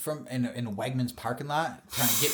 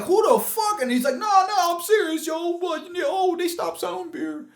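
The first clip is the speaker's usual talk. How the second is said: imitating the dude; 5 dB louder than usual. Puerto Rican dude retold, high.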